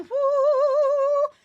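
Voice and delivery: high, loud sung whoop. Real, not acted. Teacher cheering the class's correct answer, loud sung whoop. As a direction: let out a big celebratory woo.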